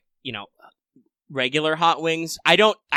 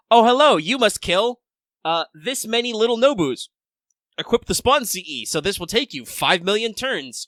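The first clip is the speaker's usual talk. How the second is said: mock game prompt; 7 semitones higher, 4 dB louder than usual. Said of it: "where it's like".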